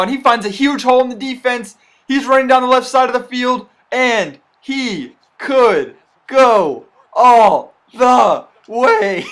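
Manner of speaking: excited call, voice breaking high; voice cracking up an octave. Real, not acted. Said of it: "I'm sorry, my voice just cracked".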